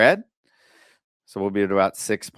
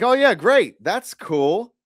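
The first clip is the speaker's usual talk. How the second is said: high, loud mock-cheerful sarcasm; sarcastic; 10 semitones higher, 5 dB louder, much slower than usual. Investor mocking lender's pass, sarcastic high great. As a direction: fake delight that drips sarcasm. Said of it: "And I'm like".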